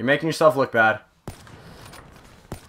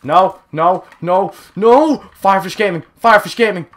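high, loud frantic cries; urgent, raised-pitch yelling; frantic outburst; 6 semitones higher, 7 dB louder than usual. Real, not acted. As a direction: cry out in a burst of game panic. Panics in game, chants own name.

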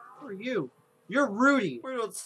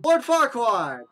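high, loud exclamation; excited recognition; 4 semitones higher, 8 dB louder, slower than usual. Real, not acted. Player spotting a familiar character, loud excited cry. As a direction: blurt out the name on spotting him.

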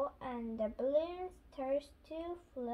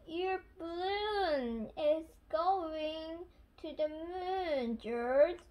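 slow, higher, stretched-out call; 5 semitones higher, slower than usual. A narrator's reading. Peppa tells George his balloon is leaving, calling out. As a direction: draw out the words as if watching it drift off.

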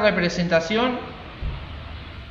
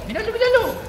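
high-pitched, panicky warning call; urgent warning; 12 semitones higher, 4 dB louder than usual. Real, not acted. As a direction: shout a warning, getting louder. Warning as monster charges.

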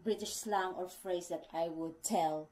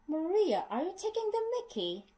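much higher, fast playful teasing; teasing colleague; 11 semitones higher than usual. Retold or imitated. Coworker teasing Maria at the office, high playful mimic. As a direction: mimic a cheeky coworker poking fun, pitched way up. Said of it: "my colleagues at work would say".

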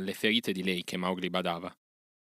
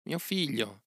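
higher, fading loving echo of figlio; 6 semitones higher, slower than usual. Acted. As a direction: let it fall away tenderly. Mother repeats, softer.

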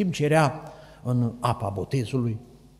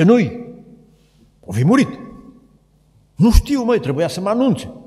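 high, loud, fussy sorcerer; mock sorcerer voice; 10 semitones higher, 6 dB louder than usual. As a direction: play the puzzled sorcerer. Sorcerer and medium quoted dialogue. (vrăjitorul, vorbit sus și tare)

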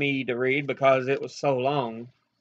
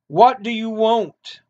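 mildly gruff question, pitched higher; lightly voiced gruff policeman's demand; 6 semitones higher, 5 dB louder than usual. A narrator's reading. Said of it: "the policeman asked her gruffly".

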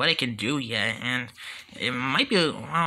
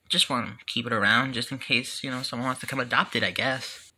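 usual speaking voice

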